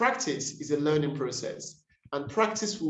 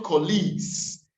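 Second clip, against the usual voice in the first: colleagues stressed on the second syllable; misplaced stress. Shown as a demonstration. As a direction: say colleagues wrongly as co-LEAGUES. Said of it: "fundamental misplacement of stress".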